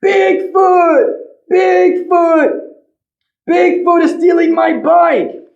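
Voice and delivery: put-on boy yelling that his bike is stolen; screaming. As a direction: scream for help as your bike vanishes. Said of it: "Ben screams".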